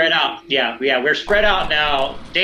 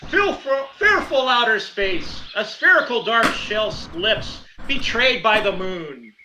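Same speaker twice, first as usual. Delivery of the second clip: slow, raised, solemn verse; dramatic haiku recital; 6 semitones higher, slower than usual. Acted. Warrior reads off another haiku to the beast, declaiming. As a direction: declaim each line slowly and grandly. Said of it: "I read off another".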